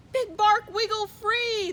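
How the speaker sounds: high, loud urgent shout. A narrator's reading. Prairie dog shouts up to Big Bark in the talons, loud shout. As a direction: call up to the sky. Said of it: "the prairie dog shouted".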